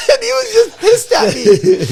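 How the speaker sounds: high and loud, broken by laughter; laughing shout. Real, not acted. Telling friends how furious dad was, laughing loudly. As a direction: blurt it out while cracking up. Laughing while retelling the story.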